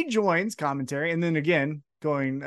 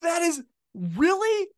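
Adam Cole's giddy reaction to the gift; excited dude; 10 semitones higher, slower than usual. Retold or imitated. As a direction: react like a thrilled bro. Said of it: "Adam Cole's like".

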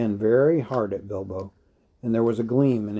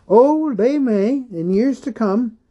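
put-on old hobbit voice; 13 semitones higher, 7 dB louder than usual. A narrator's reading. Bilbo voiced, high put-on.

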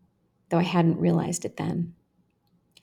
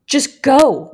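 loud, higher push; forceful urging; 7 semitones higher, 9 dB louder than usual. A narrator's reading. One boy to another, forceful urging. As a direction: egg him on hard.

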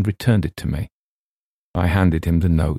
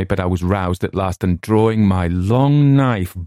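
usual speaking voice

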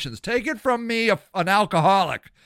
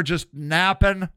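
ordinary talk

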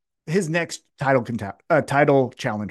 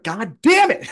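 exasperated outburst, pitched up; Swerve; 7 semitones higher, 4 dB louder, slower than usual. Acted. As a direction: curse in comic frustration, voice jumping high. Acting Swerve foiled yet again.

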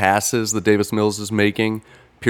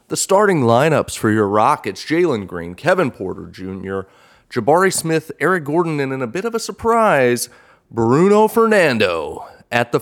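broadcast announcer calling the game; play-by-play announcer; 2 semitones higher, 4 dB louder than usual. Pretend. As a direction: call it like a live TV broadcast. Mock play-by-play of several games.

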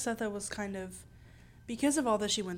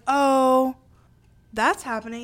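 loud, raised-pitch oblivious reaction; mock obliviousness; 4 semitones higher, 12 dB louder, slower than usual. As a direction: play the clueless witness, loudly. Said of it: "he'll be like".

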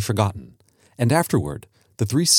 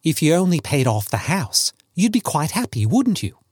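put-on higher-pitched young man's voice, rising; coaxing son; 6 semitones higher than usual. Son suggesting his father pay off the house, coaxing. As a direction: coax him, lifting the pitch as it goes.

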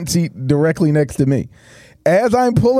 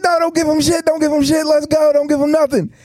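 higher, insistent "don't give him shit"; friends urging from back seat; 8 semitones higher than usual. Retold or imitated. Friends in the back seat tell her to refuse, higher and insistent. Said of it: "And they're in the back seat".

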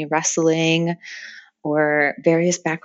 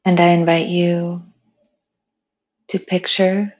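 unhurried meditation voice with long pauses; guided meditation. Acted. Meditation leader inviting friends to picture flowing water, calm and spacious. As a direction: guide them gently, phrase by phrase, leaving space.

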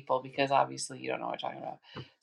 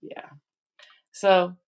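ordinary talk, nothing marked

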